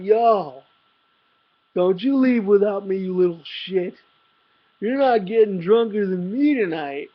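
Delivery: put-on loud, rowdy drunken bellow; drunk yell. Drunk friend yelling at narrator in the bathroom, loud rowdy bellow. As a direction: bark it like a pushy drunk buddy.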